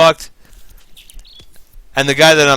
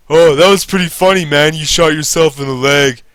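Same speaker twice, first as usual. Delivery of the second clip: higher, louder amused jeer; mocking boss; 4 semitones higher, 5 dB louder than usual. Boss calling to joke about the nail, amused jeer. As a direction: laugh off the injury like a jerk.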